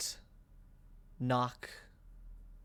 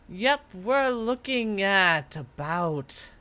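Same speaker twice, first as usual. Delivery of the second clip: spirit musing voice; 8 semitones higher, 4 dB louder than usual. Acted. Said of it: "is like".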